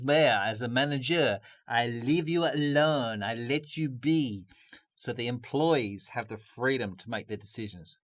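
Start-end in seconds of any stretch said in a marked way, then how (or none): 1.7-4.5 a hint of French accent, slow and easy; light French accent. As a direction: lightly voice a relaxed French manager. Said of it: "I might even do it in the French accent".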